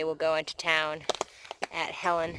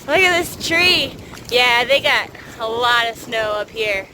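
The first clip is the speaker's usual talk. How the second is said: shouting over wind; 8 semitones higher, 8 dB louder than usual. Real, not acted. Windy snowy ridge, shouting over wind.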